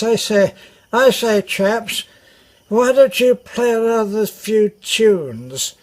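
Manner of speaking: put-on drunk posh officer. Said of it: "he's talking like this".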